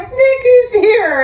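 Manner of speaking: slow, sing-song, announcing her arrival to the room; mock announcement. Clients at sober living announcing Nikki, drawn-out high call.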